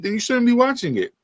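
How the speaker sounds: quick, high-pitched retold telling-off; mock scolding. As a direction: replay the parent laying down the law. Retold scolding of his wife.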